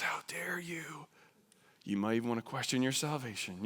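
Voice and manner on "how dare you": slow, hushed, breathless "How dare you?"; scandalized whisper. Acted. Shocked churchgoer whispering at the missionary. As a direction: whisper it in mock outrage.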